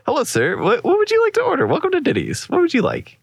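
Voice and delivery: high fast sing-song waiter voice. Pretend. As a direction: chirp it like an overeager server. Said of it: "I walk up to you".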